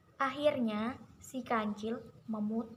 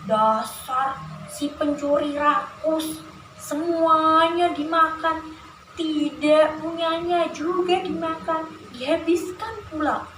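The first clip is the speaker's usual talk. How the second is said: slightly raised, indignant storybook grumbling; Pak Tani; 5 semitones higher, 8 dB louder than usual. Shown as a demonstration. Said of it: "Kalau suaranya Pak Tani gini".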